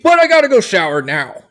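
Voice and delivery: loud, high-pitched comic grumbling yell; angry yelling. Acted. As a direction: shout it like an outraged townsperson. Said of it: "you just hear 'em start yelling".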